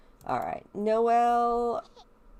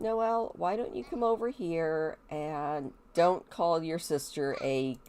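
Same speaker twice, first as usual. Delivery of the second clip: ordinary talk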